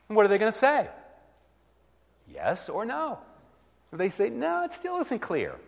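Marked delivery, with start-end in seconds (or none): 4.3-5.6 much higher, mock-plaintive listener; a hammed-up high voice for the one who is still unsure; voiced listener. Shown as a demonstration. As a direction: squeak it like the confused hearer.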